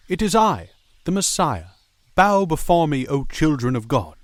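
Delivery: booming grand proclamation; loud, lofty messiah voice; dark lord. Acted.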